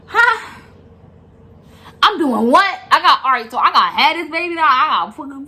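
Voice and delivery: high, loud burst of disbelief; shocked disbelief. Retold or imitated. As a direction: blurt it out loudly, stunned and panicking. Acts out shock at labor news.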